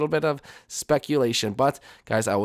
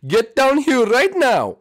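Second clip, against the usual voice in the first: higher, louder, slow command; angry call; 10 semitones higher, 6 dB louder, slower than usual. Acted. Parent orders Jeffy downstairs, angry shout. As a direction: yell it up the stairs.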